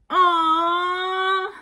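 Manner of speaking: slow, high, loud aww of adoration; cooing aww. Real, not acted. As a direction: melt over something cute. Fan coos over photocard.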